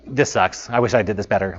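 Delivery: softly grumbling about his own work; self-critical mutter. Retold or imitated. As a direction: mutter it to yourself, disappointed. Self-critical inner quote.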